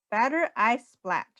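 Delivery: ordinary delivery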